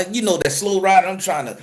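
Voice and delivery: ordinary delivery, nothing marked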